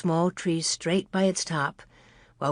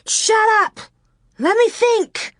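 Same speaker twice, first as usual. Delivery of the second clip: high, loud snapping screech; girl overwhelmed, shouting; 4 dB louder than usual. A narrator's reading. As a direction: screech back at her sister. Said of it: "Laura screeched".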